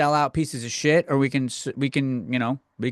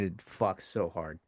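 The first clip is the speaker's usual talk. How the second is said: hushed; 10 semitones lower, 12 dB quieter, slower than usual. Real, not acted. Enthusing about shark movie.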